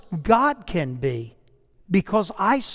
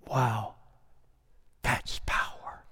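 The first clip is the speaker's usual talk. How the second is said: whisper; 6 semitones lower, 7 dB quieter, slower than usual. Real, not acted. Awed point in sermon.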